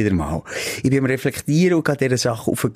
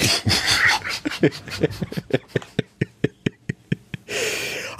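wheezing silent laugh; long breathy laugh; 4 dB quieter than usual. Real, not acted. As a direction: laugh helplessly, almost no voice. Laughs after drunk confession.

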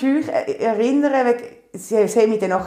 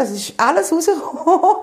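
laughing; 7 semitones higher than usual. Real, not acted. Laughing while retelling the birth.

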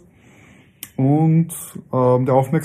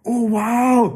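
surprised; 11 semitones higher than usual. Real, not acted. Reacting to chosen game.

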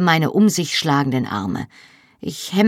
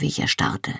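ordinary delivery, nothing marked

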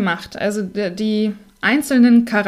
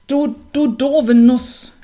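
louder, slow angry cow; cow calling out; 4 semitones higher, 6 dB louder, slower than usual. A narrator's reading. Angry cow scolding the clumsy hazelnut, slow and loud. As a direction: drag out the insult at the nut. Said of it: "rief die Kuh".

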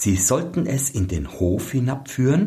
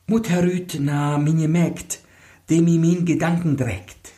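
high, otherworldly chanted spell; sing-song magic verse in a raised voice; bride's charm rhyme; 8 semitones higher than usual. Acted. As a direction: intone the charm like a secret incantation.

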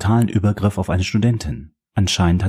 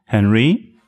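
louder call, rising at the end; 3 semitones higher, 3 dB louder than usual. A narrator's reading. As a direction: call the guard through the door. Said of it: "erhob sich und rief".

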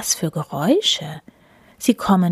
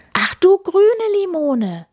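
high, louder startled exclamation; startled cry; 13 semitones higher, 4 dB louder than usual. A narrator's reading. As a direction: yelp it out in sudden alarm. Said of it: "ruft er".

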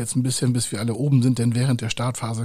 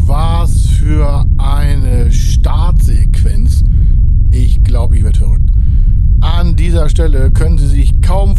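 ecstatic; 12 dB louder than usual. Acted. Ecstatic after the launch.

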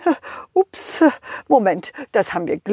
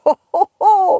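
Santa laugh; 8 semitones higher than usual. Acted.

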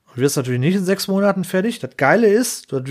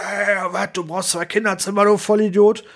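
imitating a technician blowing up, higher and rising to a yell on the insult; a hammed-up tirade that peaks at the last word; mock angry outburst; 5 semitones higher than usual. Socially awkward technician yelling at the client in a meeting, in a mock rant. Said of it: "brüllt dann los und sagt".

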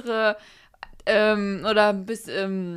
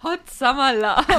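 teasing singsong; 4 semitones higher, slower than usual. Pretend. Teasing about a teenage holiday romance.

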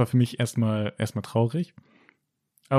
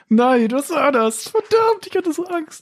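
mock panic; 6 dB louder, slower than usual. Retelling own panic after failure.

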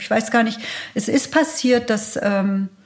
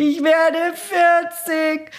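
cry out; 8 semitones higher, much slower than usual. Retold or imitated. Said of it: "bis ich endlich schrie".

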